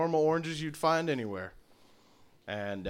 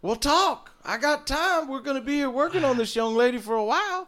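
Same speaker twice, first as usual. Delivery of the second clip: raised, drawling folksy healer; a folksy old tree healer, pitched up; tree healer's voice; 9 semitones higher than usual. Acted.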